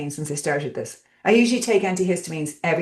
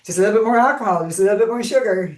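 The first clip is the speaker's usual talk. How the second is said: self-mocking indulgence; 5 semitones higher, 3 dB louder, faster than usual. Mimicking own habit of excess.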